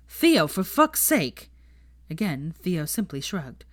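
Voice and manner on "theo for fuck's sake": exasperated outburst. A narrator's reading. Friend scolding tasteless joke.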